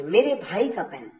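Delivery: usual speaking voice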